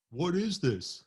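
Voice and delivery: high-pitched mock fright; scared mimicry, pitched well up; imitating scared man. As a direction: mimic someone starting to freak out. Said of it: "he was sounding scared and".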